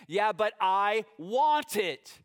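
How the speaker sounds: put-on teen insisting on the car, whining; whiny teen insisting. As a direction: stretch the want like a stubborn teen.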